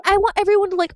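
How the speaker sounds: ordinary talk, nothing marked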